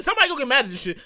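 ordinary delivery

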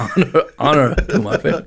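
light mock Japanese accent, laughing. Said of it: "I've ever done a racist accent before".

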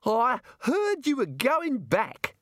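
high, very drawn-out character voice; friendly Australian academic Bill. Acted. Bill approaches departing Rincewind, in a high drawling Australian voice. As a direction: stretch it out high, a hearty Australian greeting.

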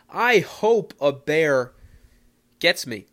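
loud, slow, emphatic outburst; emphatic declaration. Real, not acted. Man brushing off bear warnings, loud emphatic declaration. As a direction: proclaim it loudly, word by word.

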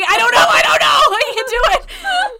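very high, loud laughing squeal; can't get it out for laughing; laughing fit. Real, not acted. Laughing fit over dirty rhyme.

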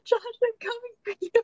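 high-pitched call, way up and fast; an excited mock yell of his name. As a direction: shout his name like she's chasing the van.

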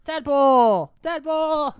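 loud excited shout. Real, not acted. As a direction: call it out loud. Shouting out a guess.

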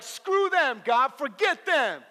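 high, loud, indignant dismissal; acted angry outburst. Hurt believer to God about offenders, acted angry outburst. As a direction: dismiss them with mock outrage. Acts hurt person rejecting others.

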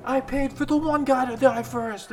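high-pitched protesting gambler in a crowd; shouting bettor. Acted. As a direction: call out a bet over the chaos. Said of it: "and you hear".

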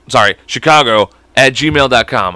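ordinary talk, nothing marked

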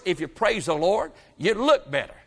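normal talking voice

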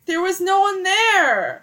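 loud, high, drawn-out defense; indignant protest. Real, not acted. Friend teases her about kissing in public, indignant protest. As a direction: protest loudly, stretching the words out.

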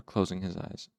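usual speaking voice

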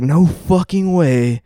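slow, drawn-out disbelief; acted incredulity; retold shock. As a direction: replay stunned disbelief, stretching the words. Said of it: "I was so fucking shocked".

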